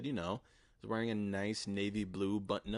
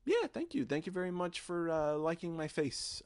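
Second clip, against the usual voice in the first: flattered; 8 semitones higher than usual. Retold or imitated. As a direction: act coy and flattered. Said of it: "it was very flattering".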